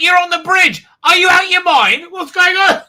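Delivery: very high, loud disbelief; incredulous outburst. Real, not acted. Reviewer yelling at the screen, very high disbelief. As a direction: shout it as if baffled. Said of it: "I'm like".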